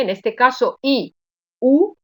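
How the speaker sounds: normal talking voice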